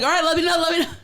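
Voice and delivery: high, loud, laughing mimicry; flustered caller imitation. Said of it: "she was like".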